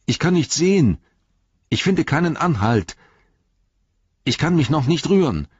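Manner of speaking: high, helpless call from below; verzweifeltes Zurückrufen. A narrator's reading. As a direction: call up that you are stuck. Frodo calls up, stuck on ledge. (hoch und hilflos hinaufgerufen)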